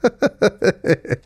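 brief real laugh, high pitched; a quick laugh breaking through; short laugh. Real, not acted. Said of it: "perdón si me da risa". (risa breve y espontánea)

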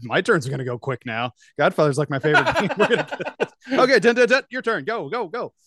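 rushing, high and loud; hurried excitement. Retold or imitated. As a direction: hurry everyone along, pumped. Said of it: "I'm like".

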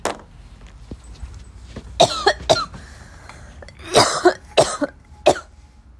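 hacking cough after a bong rip; harsh coughing fit. Real, not acted. After a harsh bong rip, loud hacking cough. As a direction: cough it out hard. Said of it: "after a harsh rip".

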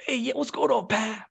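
high-pitched imitation of a relaxed uncle; smoking uncle. Uncle smoking in the kitchen greets his nephew, casual impression. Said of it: "he's smoking a, a cigarette".

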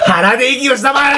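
high, mock-stern order; hammed-up indignant demand; mock-pompous command. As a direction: scold like a pompous inspector, half laughing. Mock scolding over nasal voice.

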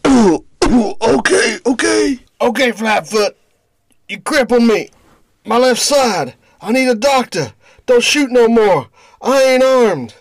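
pained pleading. Acted. Shot thug pleads for a doctor.

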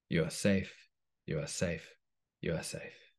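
soft, fading reassurance; calming voice. Acted. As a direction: soothe them down to a hush. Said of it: "Oxytocin's calming voice".

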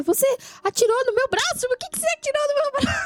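high indignant squeal; outraged shot man. Retold or imitated. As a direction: whine it up high, offended. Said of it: "aí ele vai".

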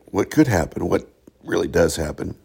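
normal talking voice

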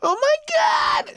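high, drawn-out squeal of joy; ecstatic kid. Retold or imitated. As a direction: squeal like a thrilled kid opening gifts. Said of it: "I know kids were just happy".

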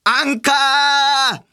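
声を張り上げた高い応援団口調; スポンサーに応援団長のように叫んでエールを送る; 応援団のエール. Acted. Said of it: "スポンサーさんへの応援団でした". (応援団風に全力で絶叫)

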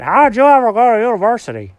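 dog doubting the cat went to university, loud and quick; puzzled dog voice. Acted. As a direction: blurt it out as a baffled dog. Alfred the dog's character voice.

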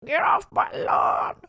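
put-on cranky old man yelling at kids on his lawn. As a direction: shoo the kids away like a grumpy old man. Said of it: "Like, on the porch".

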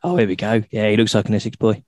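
normal talking voice

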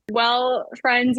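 usual speaking voice

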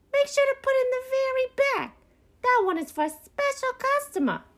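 very high-pitched bossy raccoon voice; yelling orders. A narrator's reading. Beasley orders raccoons unpacking bird feeders, yelling. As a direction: order the workers about in a high character yell. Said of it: "stand to the side and yell orders".

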